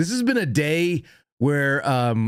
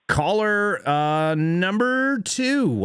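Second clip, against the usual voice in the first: radio host voice; 7 semitones higher, much slower than usual. Acted. Radio host announcing caller.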